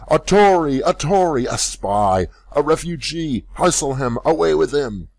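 high, loud angry shouts; crowd shouting. A narrator's reading. Bystanders shouting at Rip, angry mob yells. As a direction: yell accusations like a mob. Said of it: "a general shout burst from the bystanders".

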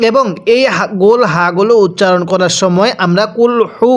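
normal talking voice

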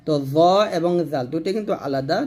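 normal talking voice

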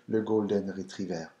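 golden retriever said with a French lilt; le golden retriever the French way, soft R; French-accented English. Shown as a demonstration. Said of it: "try to say the golden retriever".

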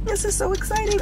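high, thrilled burst; excited. Real, not acted. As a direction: squeal it with excitement. Arriving at the new house.

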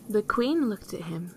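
plain reading voice

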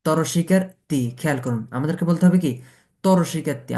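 ordinary delivery

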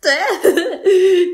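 loud mocking laugh; triumphant laugh. Sister laughing at her brother who fell for it, gloating. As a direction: gloat after tricking him.

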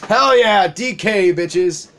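high, loud victory whoop; loud gamer cheer; excited cheer. Real, not acted. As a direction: celebrate the hit. Celebrating a hit.

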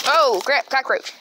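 sudden loud startled yelp. Real, not acted. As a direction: jump at the sight of a bug. Spots a cockroach suddenly.

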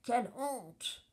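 indignant judge with swooping pitch; storming. A narrator's reading. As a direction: rage on, dragging out the shame. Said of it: "tempête le juge". (indignation tonnante du juge)

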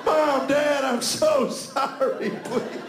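high, slow, hammed-up begging; mock pleading son. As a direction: beg like a desperate recruit writing home. Parodying son's pleading letter.

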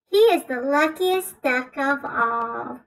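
usual speaking voice

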